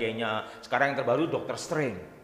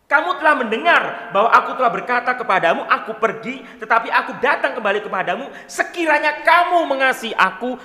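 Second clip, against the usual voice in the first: bacaan Injil lantang; 4 semitones higher, 8 dB louder than usual. Acted. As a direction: read the Gospel aloud, loud and ringing. Reads the Gospel passage. (membaca Injil dengan suara lantang)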